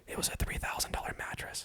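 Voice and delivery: whispered aside. Real, not acted. Husband whispering to the listener about the pricey mattress, whispered. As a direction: breathe it out as a rueful secret.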